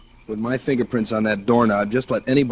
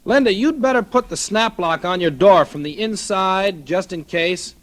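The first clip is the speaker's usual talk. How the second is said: raised voice, pitched up to carry through the door; calling through a door; 8 semitones higher than usual. Acted. Husband calls to wife behind locked door, raised. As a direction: call out to her in the next room.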